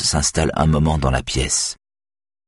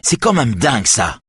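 angry shout; 7 semitones higher than usual. A narrator's reading. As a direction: blurt it out in a yell. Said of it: "crie Saga".

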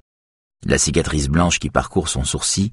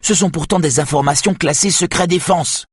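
angry protest; 12 semitones higher than usual. A narrator's reading. Saga continues angry protest.

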